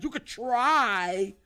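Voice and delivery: slow taunt. Real, not acted.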